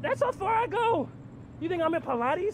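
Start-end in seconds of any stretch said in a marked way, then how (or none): 0.0-1.1 strained squeak. Real, not acted. Straining in restraints.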